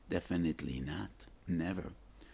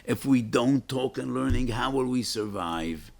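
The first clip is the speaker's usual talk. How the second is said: impassioned repeat; 5 semitones higher, 6 dB louder than usual. Shown as a demonstration. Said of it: "what my father intuitively felt".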